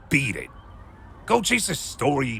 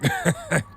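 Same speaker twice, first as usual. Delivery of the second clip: short derisive laugh; scoffing laugh. Acted. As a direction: laugh her off with contempt. Detective scoffs at journalism student.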